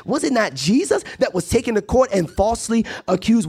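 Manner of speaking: high, rhythmic sermon cadence; impassioned preaching. Real, not acted. Preacher builds rhetorical questions.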